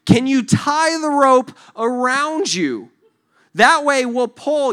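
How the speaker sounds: loud, high, slow call; rescuers coaxing. Acted. Rescuers with a new idea, slow loud coaxing. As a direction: spell it out slowly to someone below. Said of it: "then they're like".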